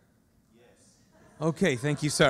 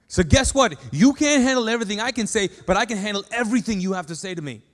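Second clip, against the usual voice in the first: raised, insistent voice, quick through the argument; God's emphatic challenge; 4 semitones higher, 6 dB louder, faster than usual. Acted. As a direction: press the point loudly and fast. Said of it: "And he goes".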